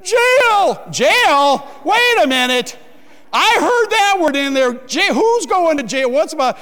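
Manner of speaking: high, loud shock at one word; alarmed disbelief. Retold or imitated. Husband hearing his crying wife say jail, shocked and loud. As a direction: yelp it out as the word sinks in.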